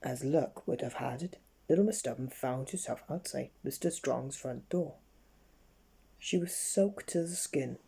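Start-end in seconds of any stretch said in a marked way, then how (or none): none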